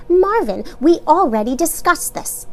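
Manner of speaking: high, firm mother. A narrator's reading. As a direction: end the discussion. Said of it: "Mama shook her head firmly".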